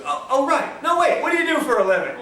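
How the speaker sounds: high, quick flustered recovery, laughing; jolted into asking again after a nudge; flustered man. Retold or imitated. Husband elbowed by his wife blurts his question out, flustered. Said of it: "his wife hits him".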